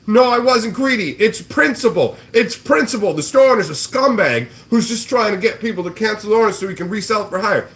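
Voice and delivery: high, loud indignant mock whine; mocking whiny flipper. Streamer mimics the flipper insisting it's principle, high whine. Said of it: "And he's like".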